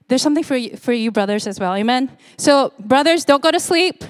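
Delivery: usual speaking voice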